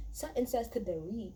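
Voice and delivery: ordinary talk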